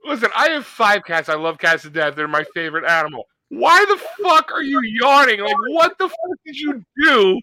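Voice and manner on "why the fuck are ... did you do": high-pitched exasperated rant at a lazy cat; hammed-up indignation; mock outraged scolding. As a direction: demand an answer in comic outrage. Mock scolding his yawning cat.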